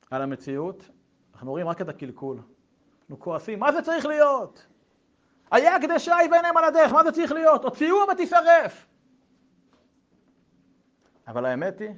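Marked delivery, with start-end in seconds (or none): 3.6-8.9 high, loud indignant shouting; mock outrage. People angrily condemning what they see, loud outraged shouting. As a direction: rail at it like an angry crowd. Angry people decrying the scene.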